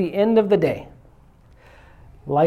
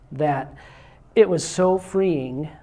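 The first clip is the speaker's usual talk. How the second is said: ordinary talk, nothing marked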